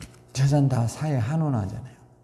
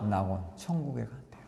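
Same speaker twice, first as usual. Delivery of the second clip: whisper; 3 semitones lower, 13 dB quieter than usual. Real, not acted. Preacher hushing the answer to his congregation, whispered. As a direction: drop from a shout to a breathy whisper. Climax then sudden hush.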